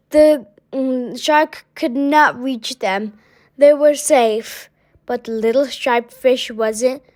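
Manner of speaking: usual speaking voice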